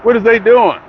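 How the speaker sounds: high, loud disbelieving question; incredulous. Retold or imitated. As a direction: ask it in playful disbelief. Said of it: "I'm like".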